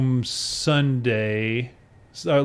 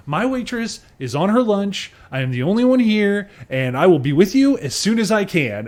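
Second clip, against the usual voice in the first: imitating waitress; 7 semitones higher, 3 dB louder than usual. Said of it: "she goes".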